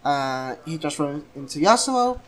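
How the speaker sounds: usual speaking voice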